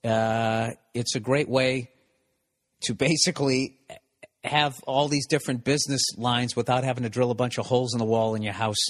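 ordinary talk

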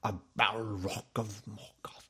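slow, soft, rough-throated wizard; lightly voiced gravelly Gandalf. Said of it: "gravelly throat voice is cool".

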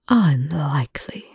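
one long, slow, smooth pour of provocation; defiant scientist slowly taunting the alien. Acted. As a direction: draw the word out slowly to goad. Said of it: "the word one long, smooth pour of pure provocation".

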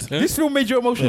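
high, disbelieving repeat; incredulous teasing. Real, not acted. Pushing a friend to admit the film moved him, incredulous. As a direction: press the point in a high, amazed voice.

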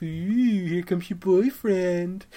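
slow, drawn-out singsong tease; schoolyard tease. Retold or imitated. Kelly teasing her sister about Daryl, slow singsong tease.

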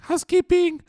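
very high sing-song call of "Housekeeping". Retold or imitated. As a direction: chirp it through the door like a maid. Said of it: "Olivia's like".

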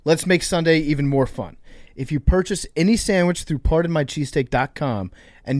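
brisk sponsor read to listeners. Acted. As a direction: sell the promo clearly. Host reads sponsor ad.

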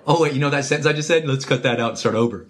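fast, fussy mock retake request. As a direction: rattle it off like a fussy perfectionist. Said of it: "you don't talk to him and go".

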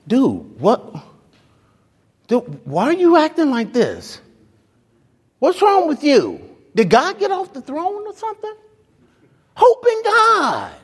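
high, rising incredulous scolding of oneself; self-talk pep. Retold or imitated. Pastor scolding himself out of despair, high and incredulous. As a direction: shake yourself awake. That was a [name] translation.

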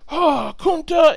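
high, loud, drawn-out mock wail of pain; mock anguished cry. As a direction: ham up a whipped man's cry, slowly. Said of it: "but it's not like".

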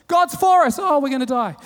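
high, loud cheer sliding into a wail; imitating fickle disciples, loud then falling; mock confidence into panic. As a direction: swing from bold shout to comic dread. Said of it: "a pretty quick shift for them".

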